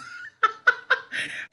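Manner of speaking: a high, breathy burst of laughter; real laugh. Real, not acted. Host laughing at the executive director's typing, high real laugh.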